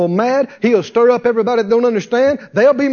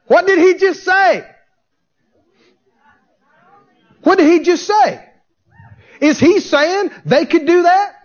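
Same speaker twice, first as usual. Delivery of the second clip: high-pitched, insistent disbelief; incredulous challenge; 6 semitones higher than usual. Acted. Preacher challenging congregation on Jesus' words, incredulous. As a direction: press the crowd with astonished questions.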